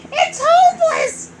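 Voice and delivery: very high, loud squeal; squeaky voice. Acted. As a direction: squeal it hopelessly. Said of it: "Toad added".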